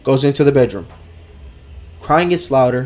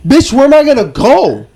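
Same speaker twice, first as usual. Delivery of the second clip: high, loud exasperated shout; exasperated yell; 12 semitones higher, 6 dB louder than usual. Retold or imitated. Man yelling back at his girlfriend, fed-up loud shout.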